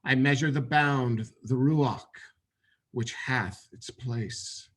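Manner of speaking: measured recital with long pauses; meditation reading. Acted. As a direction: read it as solemn scripture, phrase by phrase. Reads a meditation poem aloud.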